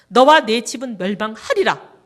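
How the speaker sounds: loud proclaimed verse; loud warning quoted to the pastor; thundering scripture warning. Retold or imitated. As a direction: hurl the warning out. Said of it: "모르드게가 에스더한테 한 그 말씀이".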